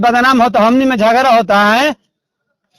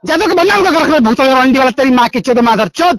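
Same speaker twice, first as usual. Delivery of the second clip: furious cursing, voice up at times; angry abuse; 3 semitones higher than usual. Real, not acted. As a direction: rage at him for shaming the community. Abusive quarrel on phone call. (गुस्सा से चिल्लात गारी)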